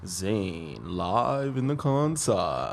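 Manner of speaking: drawn-out radio announcer. Pretend. Co-host introduced live on the podcast, FM radio voice. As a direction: stretch it out like an FM host. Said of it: "bringing you the F M voice".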